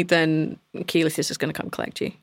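ordinary talk, nothing marked

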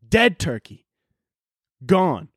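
normal talking voice

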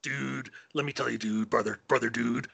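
lightly voiced wrestler, brother-and-dude patter; Hulk Hogan. Co-host spoofs wrestler's account of the meeting, light impression. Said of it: "mysterious meeting with Hogan".

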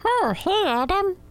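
very high, chirpy squeak; bug voice. Retold or imitated. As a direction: greet him like a cheerful tiny bug. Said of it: "Bug voice".

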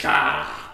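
loud animal squall; imitated raccoon screech. Wounded raccoon crying at the hunters, imitated screech.

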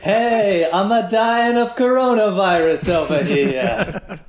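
high, loud hammed-up American accent done by an Italian, laughing through it; mock American accent. Mocking an imagined Italian podcast's American accent, laughing. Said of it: "they're just trying to do, like, an American accent".

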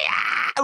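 whispered overwhelmed wail; teary cry. Retold or imitated. Woman losing it over a calf photo, breathy wail. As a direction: let out a breathy emotional cry.

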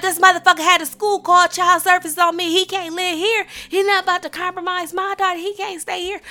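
high-pitched, insistent rant; going off. Retold or imitated. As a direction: rant on one high, agitated note. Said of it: "So I'm just going off".

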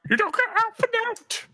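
very high, thin mock northern voice; penguin-like high pitched take; squeaky high voice. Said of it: "It's just high pitch".

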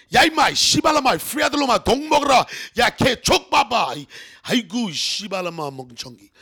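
loud, high tirade of Korean swearing; hammed-up shouted insults, hitting every phrase hard; cursing in Korean. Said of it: "everybody wanted to know the curse words first".

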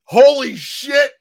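high, slow, amazed outburst; ecstatic exclaim. Retold or imitated. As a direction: gush with stunned delight. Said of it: "I was just like".

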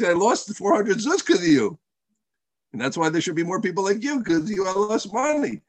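high-pitched mock outrage; aggrieved accusation. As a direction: blame him for the loss, imitating the man. Angry man blames Hillel.

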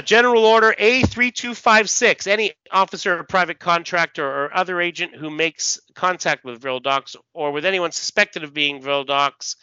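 starts high and loud, easing down; official order readout. Acted. Officer reads standing orders to a colleague, declaimed readout.

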